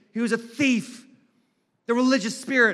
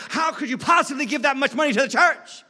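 higher mock-outraged question; incredulous critic; 5 semitones higher than usual. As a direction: act out a shocked objector. Voicing outraged critic of giving.

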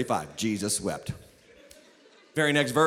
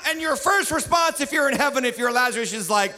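ordinary talk

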